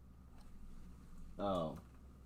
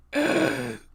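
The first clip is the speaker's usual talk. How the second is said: loud, high, shocked gasp; a sudden gasp of disbelief; real startled gasp; sharp gasp; 7 dB louder than usual. Real, not acted. Shocked at the stock chart.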